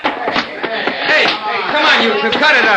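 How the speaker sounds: high, loud call to stop; shouted intervention. Acted. Friend separating two fighting brothers, shouting urgently. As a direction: shout over a brawl to break it up.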